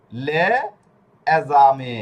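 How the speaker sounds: teacher's deliberate two-part লে ... এজামে; pronunciation demo. Shown as a demonstration. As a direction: sound out "লে এজামে" with an E after the L. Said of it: "হয়ে গেছে কি?".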